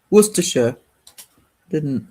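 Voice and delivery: normal talking voice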